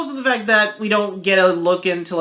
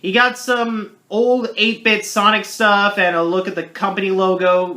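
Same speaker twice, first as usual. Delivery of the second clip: normal talking voice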